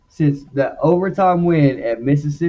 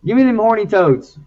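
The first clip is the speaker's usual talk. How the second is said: playful call; 8 semitones higher, 3 dB louder than usual. Real, not acted. Joking pick, mock mascot call.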